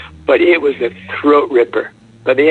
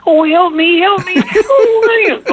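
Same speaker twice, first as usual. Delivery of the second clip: put-on very high, squealing little girl crying for help; screaming little girl; 4 dB louder, slower than usual. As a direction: play a terrified little cartoon girl begging to be saved. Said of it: "giggly girls and crying".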